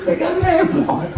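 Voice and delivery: high teasing jab; high teasing quip; mocking jab. Real, not acted. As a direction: poke fun at the remote. Mocking late-night remote.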